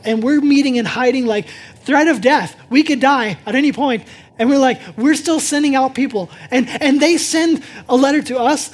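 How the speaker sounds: loud, rising sermon voice, slowing on key phrases; full-force preaching. Acted. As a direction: preach it out to the whole room.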